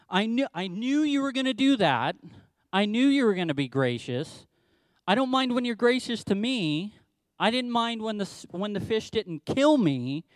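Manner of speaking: a petulant prophet throwing a fit at God; dramatic tantrum. Retold or imitated. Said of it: "he gets so dramatic and says".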